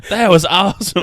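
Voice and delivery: high, loud mock Southern cheer; copying a friend's voice. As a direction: mimic the silly Southern guy voice. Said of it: "whenever you do that voice".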